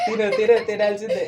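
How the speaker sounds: laughing, loud urging. Real, not acted. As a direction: egg him on through laughter. Urging the kid to eat. (నవ్వుతూ బలవంతం)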